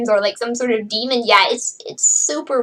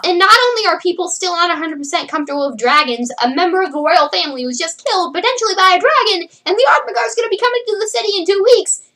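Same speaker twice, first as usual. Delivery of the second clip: rising higher and faster as it piles up; hurried build-up; 7 semitones higher, faster than usual. Real, not acted. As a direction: rattle off the bad news in a high, rushed pitch. Piles up reasons, building urgency.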